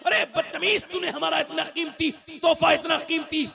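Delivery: high-pitched acted parental telling-off; mock scolding. Parent berating a child over a broken gift, mock scolding.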